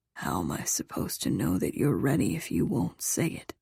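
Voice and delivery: low, soft male murmur; whispered in her ear. A narrator's reading. Lover coaxing her to say she's ready, low whisper. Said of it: "he whispered in my ear".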